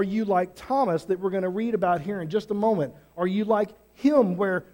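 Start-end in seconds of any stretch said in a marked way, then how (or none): none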